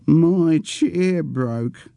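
put-on high, slow, tearful old man's voice; a childish old man near tears, very slowly.

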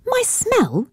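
put-on high, sharp indignant girl; sharp indignation. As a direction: bristle at the insult, young and offended. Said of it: "her voice sharpening to a pinpoint of indignation".

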